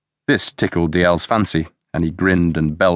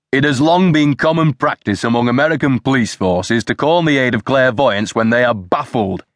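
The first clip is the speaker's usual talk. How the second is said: raised, indignant read-out; scornful reading aloud; 6 semitones higher than usual. A narrator's reading. Superintendent reads the newspaper to his men, scornfully. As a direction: read it out as if outraged.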